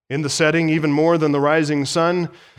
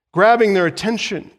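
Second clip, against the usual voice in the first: ordinary delivery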